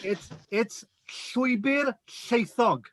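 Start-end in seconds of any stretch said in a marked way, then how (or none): 1.3-2.9 Llwybr Llaethog said very slowly, Welsh ll sounds. Shown as a demonstration. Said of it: "Listen carefully".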